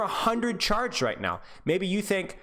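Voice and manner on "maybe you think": ordinary delivery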